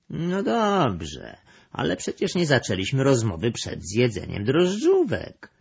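high-pitched puzzled objection; surprised. A narrator's reading. The cat objects that they waited until after the buns, surprised. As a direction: question it in mild astonishment. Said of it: "zdziwił się kot makawity".

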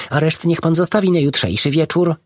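high squeaky voice; mouse squeak. A narrator's reading. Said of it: "pisnęła biała mysz".

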